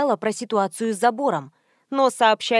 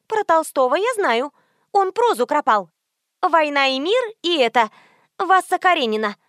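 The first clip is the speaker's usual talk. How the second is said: higher-pitched, cocksure know-it-all; confident socialite; 5 semitones higher, 4 dB louder than usual. A narrator's reading. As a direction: show off half-remembered knowledge, pitched up.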